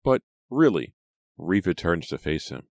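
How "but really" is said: high, loud exasperated plea; exasperated man. A narrator's reading. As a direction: plead in frustration. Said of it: "uttered an exasperated cry".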